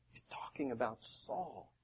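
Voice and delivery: hushed whisper; confiding whisper to the congregation. Acted. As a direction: lean in and whisper it. Aside, repeating a point.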